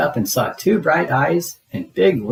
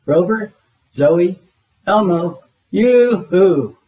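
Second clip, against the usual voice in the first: high, loud calls across the campsite; calling out loud; 5 semitones higher, 5 dB louder, slower than usual. A narrator's reading. Big Bird searching for hiding friends, loud calling. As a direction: sing out each friend's name, loud. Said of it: "Big Bird called".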